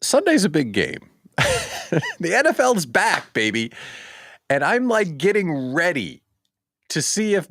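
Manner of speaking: high, loud football hype; excited hype. Real, not acted. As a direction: pump up the crowd. NFL hype.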